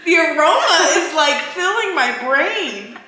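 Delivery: high hysterical laughter, gasping; nervous breakdown. Real, not acted. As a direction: laugh helplessly, out of control. Bad bean, can't breathe.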